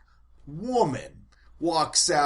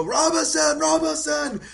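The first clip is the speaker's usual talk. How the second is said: much higher, louder calling of the name; excited screaming; 13 semitones higher, 7 dB louder than usual. Retold or imitated. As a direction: scream the star's name with joy. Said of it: "screaming out".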